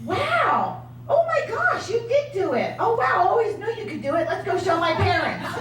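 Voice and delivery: high, laughing, delighted; a hammed-up gushing husband; amazed husband. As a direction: play the husband suddenly thrilled. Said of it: "he says".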